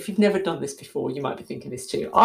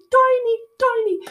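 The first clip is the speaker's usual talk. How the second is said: much higher squeak on tiny, tiny; squeaky tiny. Acted. To listeners, describing the little stick: high squeak. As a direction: squeak the words tiny, tiny to make them small.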